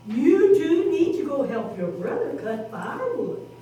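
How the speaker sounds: mild motherly voice; Mama Pig. Acted. Mama Pig tells two sons to help brother. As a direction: gently tell them to help. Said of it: "she said".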